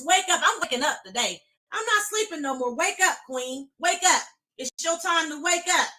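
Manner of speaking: loud, high, fervent affirmation; rallying declaration. Shown as a demonstration. Preacher leading a fervent declaration to wake up. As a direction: declare it with fire. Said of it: "I want y'all to say".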